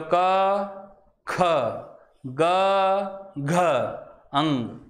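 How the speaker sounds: क, ख, ग, घ, अंग each said separately; isolated letter sounds क, ख, ग, घ, अंग for pupils to repeat; consonant pronunciation demo. Shown as a demonstration. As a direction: sound out क to अंग with pauses between. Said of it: "करें उच्चारण वापस एक बार".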